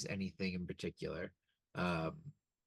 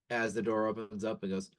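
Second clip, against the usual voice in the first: ordinary talk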